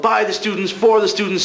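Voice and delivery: mock shout of a drama teacher at full volume; retold yell. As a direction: belt out By and for like a furious teacher. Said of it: "Larker yelled".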